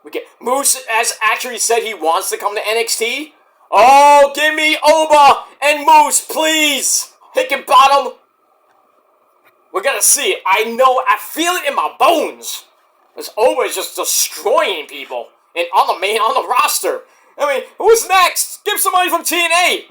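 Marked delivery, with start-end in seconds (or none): 3.7-8.2 high, loud eager plea drawn out slowly; excited plea. Real, not acted. Fan begging the show for his dream match, eager and loud. 17.4-19.8 high, rushing fan hype; excited hype. Real, not acted. Fan wondering who the champion fights next, high and eager.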